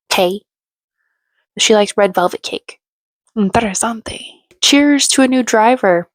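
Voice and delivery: ordinary talk, nothing marked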